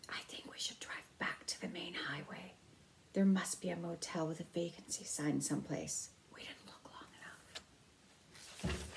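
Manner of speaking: unvoiced hushed whisper. Mother whispering to her husband about leaving, in a whisper. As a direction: whisper it so the staff can't hear.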